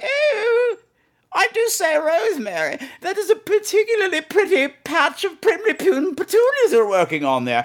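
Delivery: put-on high, prim, genteel old English lady; prim British lady. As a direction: play a sweet gossiping English lady over tea. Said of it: "the conversations are always like".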